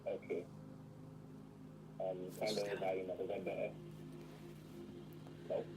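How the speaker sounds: muffled remote voice. Real, not acted. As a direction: voice muffled by headphones. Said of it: "It's just muffled".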